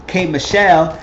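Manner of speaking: ordinary delivery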